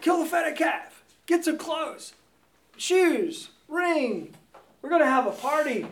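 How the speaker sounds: high, loud father barking orders; barking commands. Retold or imitated. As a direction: snap out orders like a thrilled father. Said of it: "he starts barking out commands".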